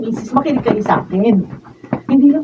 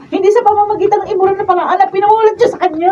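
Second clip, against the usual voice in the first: heated rebuke; 8 semitones higher, faster than usual. Acted. Wife confronts aunt.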